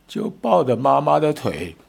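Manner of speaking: ordinary talk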